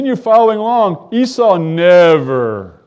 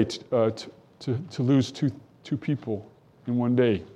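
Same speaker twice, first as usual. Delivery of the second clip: hushed; 6 semitones lower, 13 dB quieter than usual. Real, not acted. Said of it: "preacher speaks, moved".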